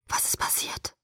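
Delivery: breathless frightened whisper; hushed, urgent whisper. Acted. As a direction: ask it under your breath. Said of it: "flüsterte ich wieder".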